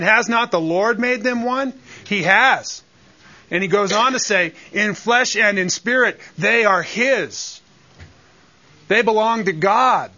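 rising sermon fervor; fervent preaching. Acted. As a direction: press the point home to the congregation. Preaching climax on verse.

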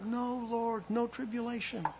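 high, pleading mock protest; mock cry of protest. Disciples crying out against tribulation, high mock pleading. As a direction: plead like a frightened disciple, half in jest. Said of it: "they cried out".

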